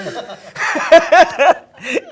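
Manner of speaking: loud, high-pitched laughing; real burst of laughter. Real, not acted. Actor laughs at his childhood tears, loud burst. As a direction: crack up at your own story.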